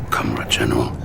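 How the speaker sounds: sinister whisper; whispered taunt; menacing whisper. Acted. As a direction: hiss it close and threatening. Villain taunts general menacingly.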